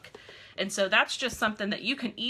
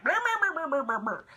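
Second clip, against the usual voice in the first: mock spam babble; 6 semitones higher than usual. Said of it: "she just went like".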